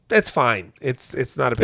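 mock a therapist brushing it all off; lightly voiced soothing therapist; dismissive therapist. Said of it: "she's literally like".

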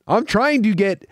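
ordinary talk, nothing marked

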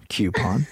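low, questioning "cue-pon" for coupon; coupon with a "kyoo". Shown as a demonstration. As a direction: try out coupon as KYOO-pon, lifting at the end. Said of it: "It didn't sound off".

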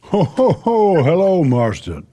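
jolly booming ho ho ho; big hearty Santa laugh then greeting. Acted. Santa greets child caller.

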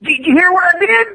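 high, excited voice of an eager comic writer; the writer bragging to a friend, high and eager; Robert Kirkman impression. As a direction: pitch it way up, like a proud kid. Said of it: "there's Robert Kirkman".

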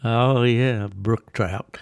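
ordinary talk, nothing marked

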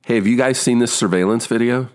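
high, bright line reading; acting an audition line. Actor reading his first audition line, upbeat. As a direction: deliver the scripted line with energy. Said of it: "the first line is".

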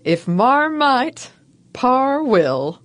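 "If Marmite, par will" sung out high and slow; grand mock ad read; hammed-up slogan. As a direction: stretch "Marmite" like a jingle. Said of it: "Perhaps the slogan will help".

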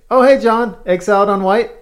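high, loud friendly restaurant worker; cheery shop greeting. Retold or imitated. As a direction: greet a regular warmly. Staff greeting regular customer.

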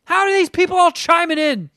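high exasperated swearing rant; swearing tirade. Real, not acted. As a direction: vent at the impossible questions. Ranting about Jeopardy.

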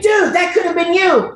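high-pitched, hyped-up buddy outcry; exclaiming friends. Retold or imitated. Friends telling the actor what he missed, exclaiming.

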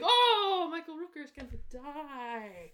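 loud high "oh" sinking into a slow line; hammed-up, drawn-out lament; mock dismay. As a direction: wail the realisation, then let it fall. Acting out predicted realisation.

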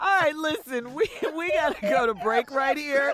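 talking through laughter; laughing. Real, not acted. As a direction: still cracking up while wrapping up. Laughing after the skit.

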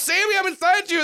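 very high acted scream; high, hammed-up yell; mock scream. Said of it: "she just, she screams".

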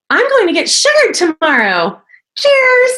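high, bubbly mock voice; imitating the girl. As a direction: play the chirpy girl up high. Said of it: "she said it just like that".

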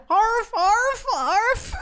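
high, comic yapping; imitated dog barking. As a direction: bark like an excited little dog. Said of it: "that bitch starts barking".

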